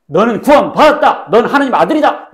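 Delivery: booming declaration of sonship; voice of God proclaiming. Retold or imitated. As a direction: announce it like a voice from heaven. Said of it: "음성 들었어요?".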